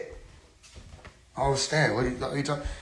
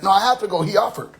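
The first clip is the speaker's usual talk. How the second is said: mocking voice; 8 semitones higher than usual. Mocking others' excuse.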